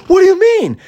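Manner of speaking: very high loud puzzled question; puzzled dog voice. Acted. Dog asking the girl about losing, high puzzled squeak. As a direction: squeak it out like a confused pup.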